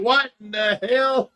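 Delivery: loud, high, slow astonished outburst; baffled grandpa. Retold or imitated. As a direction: grandpa walking in on a mess, half-shouting. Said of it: "Grandpa was like".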